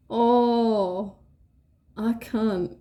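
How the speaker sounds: usual speaking voice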